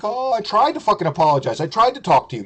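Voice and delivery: high-pitched mock protest; mocking complaint. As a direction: mimic a pleading excuse, high and exaggerated. Mocks co-host's complaint.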